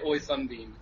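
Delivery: soft, low British "oi"; half-hearted British accent, dropped down low; mock British accent. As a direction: quietly attempt a British accent. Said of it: "I can only say".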